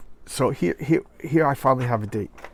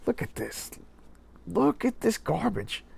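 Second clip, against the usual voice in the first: disgusted; 8 semitones higher, 8 dB quieter than usual. Real, not acted. Disgust at comic art.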